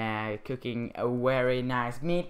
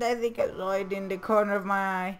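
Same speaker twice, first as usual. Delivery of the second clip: excited; 8 semitones higher, 3 dB louder, faster than usual. Real, not acted. Still excited after finding exit.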